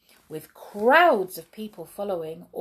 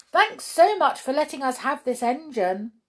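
higher, gleeful call; cheeky thanks called out; delighted; 6 semitones higher, 3 dB louder than usual. A narrator's reading. Brownies escaping with the engine, delighted call. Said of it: "waving their hands in delight".

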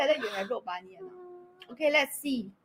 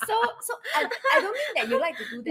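laughing; 12 semitones higher, faster than usual. Real, not acted. Laughing reassurance.

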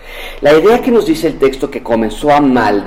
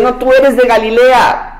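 acusación; 7 semitones higher than usual. Acted. Acusadores señalan a Pedro.

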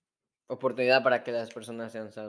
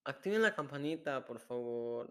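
softer, tentative go at a catchphrase; attempted catchphrase; 8 dB quieter than usual. Shown as a demonstration. Cohost retrying the bell catchphrase, soft failed attempt. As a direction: try the catchphrase quietly, fading on the please. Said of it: "No, así no, no me sale".

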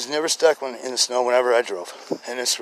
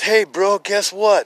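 loud, high phone greeting; retold excited news; 8 semitones higher, 9 dB louder, much slower than usual. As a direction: burst in with big news for a brother.

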